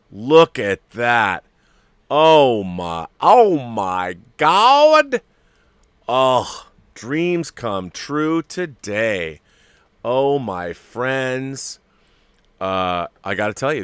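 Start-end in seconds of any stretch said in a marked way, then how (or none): 0.1-5.2 slow, loud, drawn-out cry, rising high on "God"; overwhelmed excitement. Real, not acted. Staring at the figure, a slow, loud "oh my God". 6.1-11.8 slow delight. Real, not acted. Delighted to viewers.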